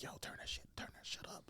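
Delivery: hushed, urgent crew whisper; whispered complaint. Acted. Sound crew struggling to hear the actress, urgent whisper. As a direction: whisper it to a colleague on set. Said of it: "I bet the sound people like".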